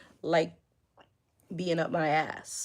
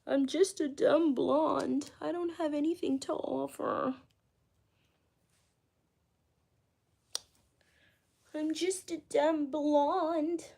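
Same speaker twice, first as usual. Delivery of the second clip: mock dumb blonde; 8 semitones higher than usual. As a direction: play the helpless dumb blonde. Mock ditzy voice.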